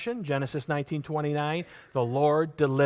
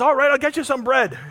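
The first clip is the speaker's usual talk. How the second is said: higher, louder, exasperated surrender; giving in; 9 semitones higher, 7 dB louder than usual. Acted. Worn-down neighbor finally caving to the knocking friend, exasperated and loud. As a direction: give in just to stop the noise. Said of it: "finally the guy says".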